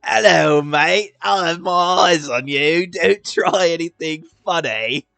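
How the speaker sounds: put-on loud hammy British accent; mock British accent. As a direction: ham up a Cockney warning. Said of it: "why did she say it like that".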